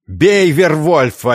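loud, high, stretched-out crowd yell; slow, full-voiced rallying cry; furious shout. A narrator's reading. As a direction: rouse the mob against him. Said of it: "яростно выкрикнули из толпы".

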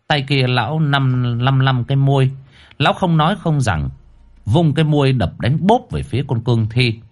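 ordinary delivery, nothing marked

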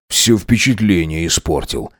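low, disgruntled complaint; grumbling. A narrator's reading. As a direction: grumble it low under your voice. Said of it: "проворчал Максимилиан".

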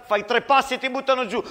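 captain yelling a warning; urgent warning shout. Acted. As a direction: bark the danger at him quickly. Captain warns of the sniper.

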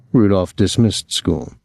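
ordinary delivery, nothing marked

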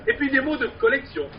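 lively recited street poem; singsong market cry. Acted. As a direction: perform it like a street barker. Performed poem with refrain.

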